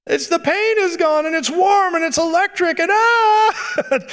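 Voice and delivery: very high imitation building to a squeal; hammed-up overwhelmed lady; ecstatic healed lady. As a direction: gush in a high mock-woman voice, ending in a cry. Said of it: "she stands up and says".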